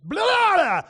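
loud blurted nonsense babble, rising high; exasperated outburst. Acted. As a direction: burst out when you can't hold it in. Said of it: "you just can't take it anymore".

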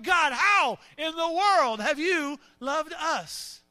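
slow, drawn-out exasperation at God; acted, slow cry of frustration; frustrated plea. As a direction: stretch each phrase in disbelief. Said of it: "your situation is so sad and frustrating".

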